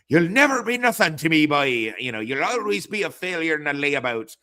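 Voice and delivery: put-on high, loud Irish-accented scolding; mock Irish father. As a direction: berate a son in a put-on Irish brogue. Voices scolding Irish father in show.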